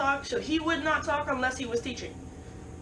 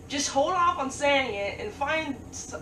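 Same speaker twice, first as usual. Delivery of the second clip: ordinary talk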